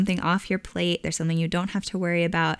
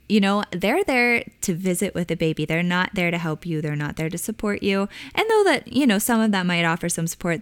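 ordinary delivery

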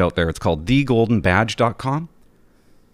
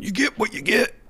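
much higher, mocking the saying; 11 semitones higher, 4 dB quieter than usual. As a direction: parody people who repeat this line, pitched high. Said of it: "How about that one?".

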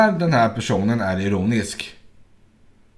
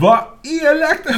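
much higher, mock-offended pout; sulky "how mean"; 14 semitones higher, 4 dB louder than usual. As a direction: play her sulking at the prank. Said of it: "Hon vart så sur på mig". (surt och mycket högre, låtsat förnärmat)

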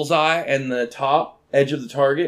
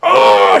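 retold yelp; 11 dB louder than usual. Retold yelp at dart hit.

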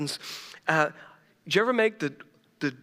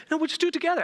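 higher wife voice; 12 semitones higher, much faster than usual. Retold or imitated. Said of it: "she's like".

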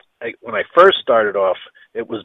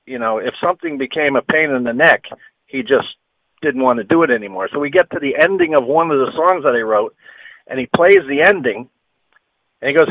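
ordinary talk, nothing marked

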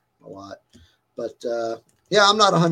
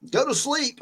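much higher mock-urgent voice; imitating worried chat; 11 semitones higher than usual. Streamer quotes the freaked-out chat, high mock urging. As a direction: pitch it up like fretting viewers.

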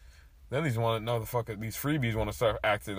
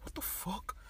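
breathy whisper, higher pitch; whispered curse; 8 semitones higher, 13 dB quieter, slower than usual. Retold or imitated. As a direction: hiss it so the teacher can't hear. Whispering during the quiz.

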